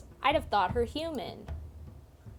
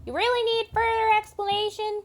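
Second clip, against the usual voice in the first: high, louder, mocking disbelief; incredulous scorn; 10 semitones higher, 5 dB louder than usual. Elf soldier mocks the newcomer with incredulous scorn.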